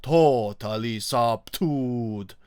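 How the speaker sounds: "totally subdued" redone slowly in an Estonian Finnish accent. Shown as a demonstration. Vlogger correcting himself into an Estonian Finnish accent. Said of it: "or excuse me".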